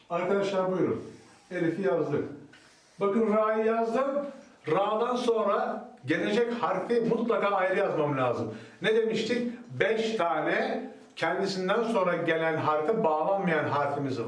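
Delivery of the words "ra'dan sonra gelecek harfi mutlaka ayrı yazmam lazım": ordinary delivery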